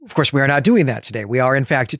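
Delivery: usual speaking voice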